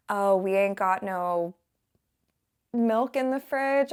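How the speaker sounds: put-on Southern accent, slipping out at home; drawled Southern "we ain't got no". Southern accent slipping out while back home. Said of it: "like last time I said something like".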